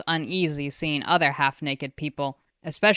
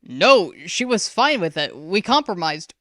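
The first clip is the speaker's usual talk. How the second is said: louder, pressing defence; urgent father; 3 semitones higher, 6 dB louder than usual. A narrator's reading. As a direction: answer quickly.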